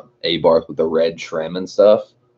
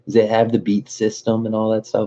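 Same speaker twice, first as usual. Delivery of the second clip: higher, gushing car fan; gushing girl; 5 semitones higher than usual. Retold or imitated. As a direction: squeal over the cute car like a fan. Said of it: "She's like".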